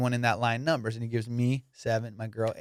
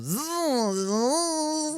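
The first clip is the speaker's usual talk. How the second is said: power washer buzz; 14 semitones higher than usual. Retold or imitated.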